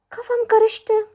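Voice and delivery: high put-on voice; mock confused. Learner jokes in Turkish she's confused, high put-on voice. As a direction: play a baffled cartoon self.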